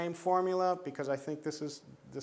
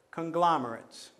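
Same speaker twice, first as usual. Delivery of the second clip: "conglomerate" stressed on GLOM; stress on second syllable; 4 dB louder than usual. Shown as a demonstration. Teacher showing where stress falls, stressing GLOM in "conglomerate". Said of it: "that's where the stress would fall".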